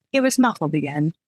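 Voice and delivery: ordinary delivery, nothing marked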